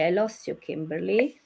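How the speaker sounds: usual speaking voice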